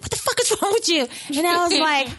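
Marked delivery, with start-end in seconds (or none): 0.0-1.1 high, indignant mock outburst; pissed-off retort. Wife retelling her partner's reaction to her movie theater scream, mock pissed off. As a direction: imitate an angry, startled partner. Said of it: "he was so pissed".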